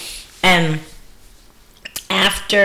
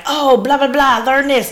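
imagined gushing friend; 7 semitones higher, 4 dB louder than usual. Retold or imitated. Imagined friend reporting the class.

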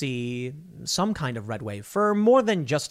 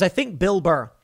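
usual speaking voice

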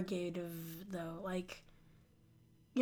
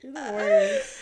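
mock outrage; 5 dB louder than usual. Real, not acted. Teasing friend over Eddie.

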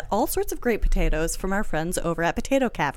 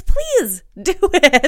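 pleading; 7 semitones higher, 6 dB louder, much slower than usual. Pretend. Begging listeners.

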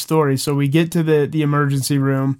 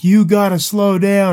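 higher, louder and slow, like each bump hurts; mock pained yell; 6 semitones higher, 4 dB louder than usual. Injured rider begging his buddy in the truck to slow down, a mock pained yell. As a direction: replay the yell of pain in a lighter, acted way. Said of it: "I would be screaming".

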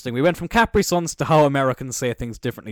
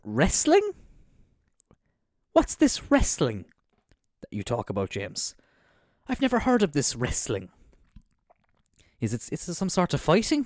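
feigned ignorance; 3 semitones higher, 3 dB quieter than usual. Pretend. Feigning ignorance of his favourite subject.

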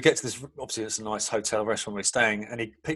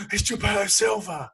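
put-on hushed gasp, then a higher, slow child's voice; awed child; 9 semitones higher than usual. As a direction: gasp like a starstruck kid and say it slowly. Child recognizing star at school.

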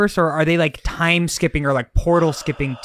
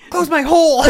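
high, louder put-on cry; hammed-up high yell; mock hero shout; 13 semitones higher, 5 dB louder than usual. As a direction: shout it like an overacting game hero. Parody of hero shouting to Goofy.

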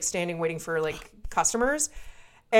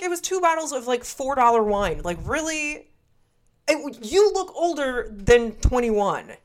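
indignant disbelief; 4 semitones higher than usual. Retold or imitated. Indignant retelling.

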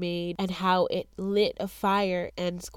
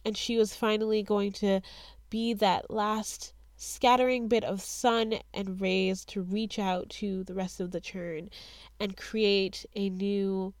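normal talking voice

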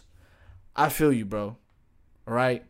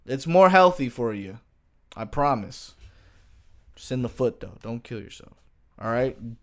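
ordinary talk

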